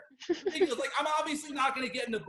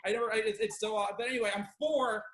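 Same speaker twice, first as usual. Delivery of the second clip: usual speaking voice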